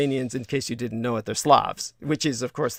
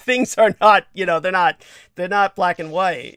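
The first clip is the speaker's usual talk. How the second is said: higher, louder, laughing through the words; 4 semitones higher, 10 dB louder than usual. Real, not acted. Host admitting to co-hosts it's complicated, laughing. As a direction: laugh while explaining it away.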